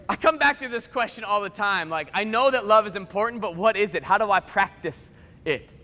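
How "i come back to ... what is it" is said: usual speaking voice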